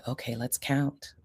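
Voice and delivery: soft, slow count; soothing slow count aloud; calm counting. Shown as a demonstration. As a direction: count gently to settle him. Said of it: "So then I might say".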